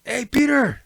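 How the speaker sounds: high call across to a friend. Retold or imitated. Friend calling Peter over to see the lights, high call. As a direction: call his name up high to get his attention.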